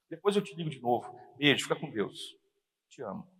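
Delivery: soft, loving phone goodbye; tender. Retold or imitated. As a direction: sign off gently to your wife. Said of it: "Fala com carinho".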